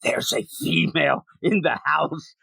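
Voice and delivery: ordinary delivery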